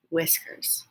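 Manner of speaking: hushed, small reply; whispered answer. Acted. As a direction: barely breathe the word.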